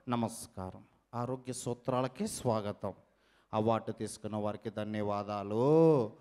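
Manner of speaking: deep, soft host voice; health-show host impression. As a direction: welcome them low and softly, as a health presenter. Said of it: "unique mimicry concept". (లోతైన, మెల్లని వ్యాఖ్యాత గొంతు)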